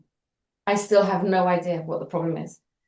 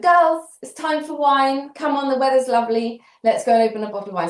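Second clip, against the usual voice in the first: put-on rally the girls for wine with a raised, bright voice; cheery call to the girls; 6 semitones higher, 3 dB louder, faster than usual. Calling friends for wine.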